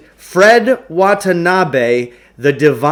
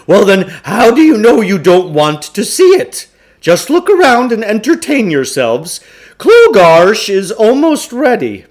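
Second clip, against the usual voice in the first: higher, louder, self-important showman voice; pompous huckster; 7 semitones higher, 6 dB louder than usual. Acted. Huckster urging the boys to stay, grandly pompous. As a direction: puff yourself up like a carnival salesman.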